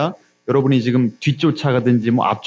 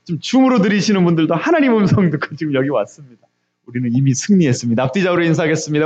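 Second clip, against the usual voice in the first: ordinary talk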